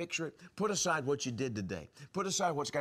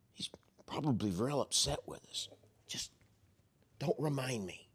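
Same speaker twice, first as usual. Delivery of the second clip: whispering. Retold or imitated. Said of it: "were whispering".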